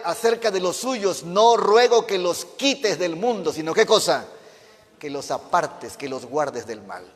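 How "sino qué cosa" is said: normal talking voice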